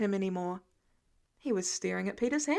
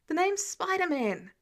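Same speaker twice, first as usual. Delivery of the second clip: ordinary delivery, nothing marked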